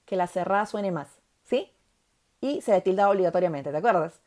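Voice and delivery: ordinary talk, nothing marked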